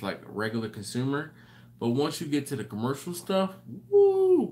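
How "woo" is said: high loud "woo"; excited whoop. Real, not acted. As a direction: let out a sharp whoop. Mock whoop about cost.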